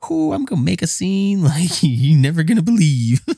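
high, loud hammy threat; hammed-up warning. Husband warning his wife he'll cry, high and hammy. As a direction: ham up a threat to make a scene. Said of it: "I was like".

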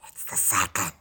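hushed creepy gremlin whisper; little gremlin. Acted. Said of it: "there's, like, a little gremlin up there or something being like".

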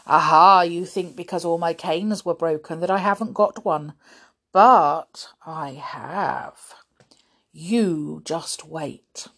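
gloat, then draw out the threat. A narrator's reading. Grimm threatening Winks with cane.

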